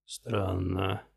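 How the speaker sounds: low, soft, menacing; quiet menace. Acted. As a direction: say it low and cold.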